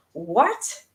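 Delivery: rising incredulous what; can't believe what she read; sharp disbelieving question; disbelief. Real, not acted. Disbelieving reaction.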